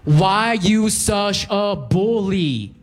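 put-on high, slow, drawn-out girl's voice; accusing girl. Cashier girl accusing the customer, high and drawn out. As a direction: stretch it out, offended and high. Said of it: "looks up at me angrily and goes".

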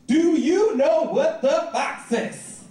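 put-on slow, high, deliberate kid voice; taunting little cousin. Little cousin up on a stool in his face, slow deliberate taunt.

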